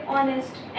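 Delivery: Honest demonstrated quietly in two beats; pause after first syllable. Shown as a demonstration. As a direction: stop briefly on the O of honest. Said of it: "ओ पे रुक गए".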